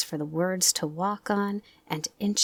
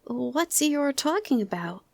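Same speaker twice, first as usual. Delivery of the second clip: Piglet's voice; 8 semitones higher than usual. Acted. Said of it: "Piglet whispered to Pooh".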